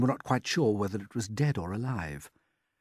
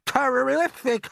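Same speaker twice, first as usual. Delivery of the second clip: a much higher, louder put-on aunt's voice, excited; Aunt Sponge; 7 dB louder, slower than usual. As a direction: play the greedy aunt gaping at the peach. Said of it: "Aunt Sponge cried out".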